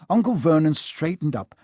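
plain reading voice

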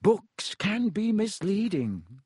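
slow and delicate, a lightly voiced vain wizard; delicately. A narrator's reading. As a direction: tiptoe around the truth, slowly. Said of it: "said Lockhart delicately".

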